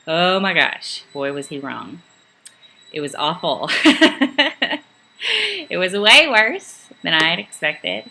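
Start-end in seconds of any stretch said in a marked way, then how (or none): 3.7-4.8 short high burst of laughter; rueful laugh. Real, not acted. Recalling the awful workload to the trainees, laughing. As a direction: laugh at how bad it got.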